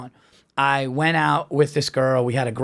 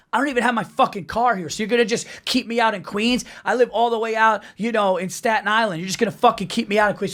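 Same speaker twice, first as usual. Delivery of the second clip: mock yelling; 6 semitones higher than usual. Date flips out about being stranded in Queens, mock yelling. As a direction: imitate her flipping out, mildly. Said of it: "Starts yelling at me".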